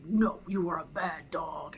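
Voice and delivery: slow, drawn-out scolding of a dog; mock-stern telling-off; angry scolding tone. Shown as a demonstration. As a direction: scold a dog slowly, sounding cross. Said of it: "tone is angry".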